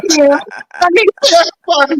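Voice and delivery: put-on high, babyish whine; mock crybaby. Crybaby hater answering co-host's teasing, mock whining. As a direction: whimper it like a big baby. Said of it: "You gotta get him on the pacifier".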